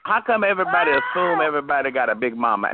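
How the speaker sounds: ordinary talk, nothing marked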